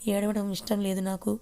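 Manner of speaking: plain reading voice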